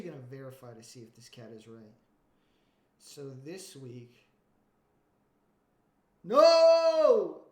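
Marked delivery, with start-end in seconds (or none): 6.2-7.4 loud, high shout of denial. Real, not acted. Streamer yells at the podcast, loud high no. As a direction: yell no at the screen. Reacting to the episode.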